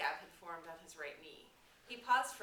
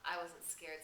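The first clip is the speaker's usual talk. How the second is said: ordinary delivery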